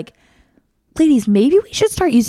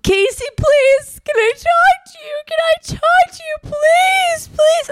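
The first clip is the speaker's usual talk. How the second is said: high-pitched, drawn-out whiny begging; desperate pleading; slower than usual. Retold or imitated. Guy begging Casey to talk, whiny pleading. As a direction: imitate a whining, desperate beg.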